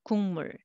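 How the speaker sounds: 국물 said as one word, ㄱ turned to ng; 국물 run together with nasal ng; linked pronunciation. Shown as a demonstration. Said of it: "but together".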